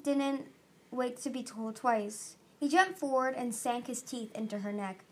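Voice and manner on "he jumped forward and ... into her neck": ordinary delivery, nothing marked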